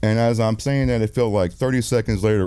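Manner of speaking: ordinary delivery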